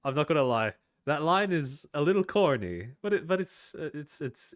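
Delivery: loud, high mock-cringe at the corny line; cringing reaction. Real, not acted. Streamer reacting to the clip's line, loud high cringe. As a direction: overplay the wince at a cheesy line.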